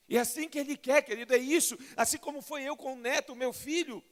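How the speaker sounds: loud, rising sermon cry; fervent preaching. Acted. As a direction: preach it with full force to the congregation. Preaching at full force. (pregação fervorosa e alta)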